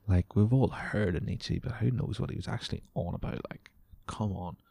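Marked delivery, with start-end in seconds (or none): none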